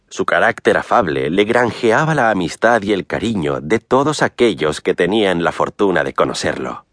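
usual speaking voice

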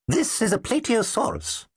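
put-on high twittery little old dinosaur voice; twittery old professor. A narrator's reading. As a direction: chirp it out like a tiny old scholar. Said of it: "said Sog".

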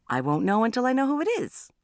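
firm, high-pitched insistence. A narrator's reading. Mother answering daughter about the caller, firm insistence. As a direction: hold your ground with rising exasperation. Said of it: "I said firmly".

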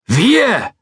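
high, loud one-word shout. Acted. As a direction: yell it back at him. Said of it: "schrie McCracken".

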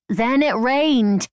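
high, very slow Irish lilt; mischievous Irish accent. A narrator's reading. Mother finishing her joke, mischievous Irish accent. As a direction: draw out the punchline in mischievous Irish. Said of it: "mischievous with an Irish accent".

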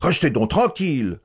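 reproche indigné plus haut. A narrator's reading. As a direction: remet l'ordre avec indignation. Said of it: "continua le professeur indigné". (le professeur rappelle la classe à l'ordre, indigné)

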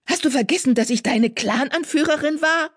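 incredulous, high-pitched rebuke; indignant hiss. A narrator's reading. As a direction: pull rank in high, affronted anger. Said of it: "zischte sie".

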